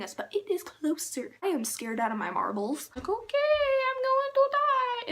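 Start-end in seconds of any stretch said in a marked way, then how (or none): 3.1-5.1 hammed-up terror; mock panicked wail. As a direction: wail it in a high, stretched voice. Retold terror while being chased.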